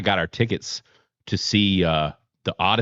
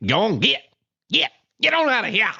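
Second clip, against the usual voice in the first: high, rising Southern "go on, get"; playful high-pitched shoo-away; shooing call; 12 semitones higher than usual. Shown as a demonstration. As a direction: shoo someone off with a high singsong. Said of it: "I love saying it".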